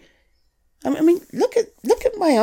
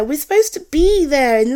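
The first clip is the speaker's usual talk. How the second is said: ordinary talk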